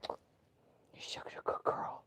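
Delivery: slow soft whisper to the dog. Real, not acted. Owner whispering slow praise to the dog. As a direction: breathe the praise slowly.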